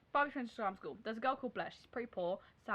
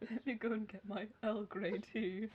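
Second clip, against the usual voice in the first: slow, softer caricature British; mock British accent; 3 semitones lower, 6 dB quieter, slower than usual. Mocking the headmistress's Britishness, slow put-on British accent. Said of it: "also kind of British".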